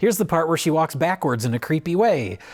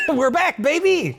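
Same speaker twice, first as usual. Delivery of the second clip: laughing cheer; 12 semitones higher than usual. Real, not acted. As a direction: celebrate the return with a laugh.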